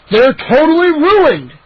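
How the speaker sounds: high, loud, slow bellow; roar. A narrator's reading. Neighbor roaring at the family over his garden, slow and loud. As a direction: drag out the despair, loudly. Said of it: "he roared".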